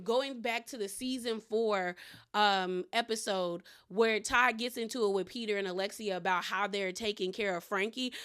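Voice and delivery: usual speaking voice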